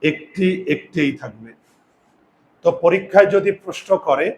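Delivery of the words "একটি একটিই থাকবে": slow, softer একটি with unchanged এ; demonstrated pronunciation. Shown as a demonstration. Teacher models the unchanged একটি slowly. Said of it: "পরিবর্তন হবে না".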